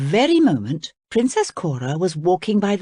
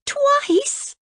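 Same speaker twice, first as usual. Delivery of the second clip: shocked cry, much higher; cried out. Acted. Cora cries out in shock at the crocodile. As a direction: gasp it out in disbelief. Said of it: "cried Princess Cora".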